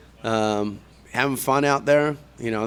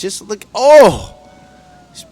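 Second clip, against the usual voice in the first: sudden thrilled shout; excited; 14 semitones higher, 12 dB louder, slower than usual. Real, not acted. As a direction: burst out in awe. Excited commentator sees big move.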